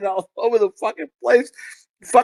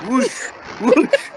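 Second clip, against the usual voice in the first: whoosh sound; 5 semitones higher than usual. Retold or imitated. Imitating gang rolling past.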